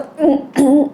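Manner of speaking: wordless choked throat noise; imitated choked sound. Father unable to speak to mother on the phone, imitated choking. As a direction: imitate a stifled catch in the throat. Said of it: "이런 소리만 나는 거야".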